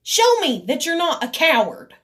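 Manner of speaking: high, exasperated challenge with loud bursts; mad, riled up. Retold or imitated. Riled up retelling how they dared her, mad. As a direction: plead angrily, punching the opening words. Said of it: "but I'm mad. You know, I get riled up".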